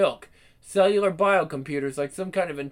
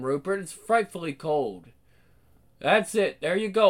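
ordinary delivery

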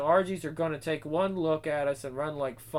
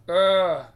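groan; 8 dB louder than usual. Acted. Loud groan.

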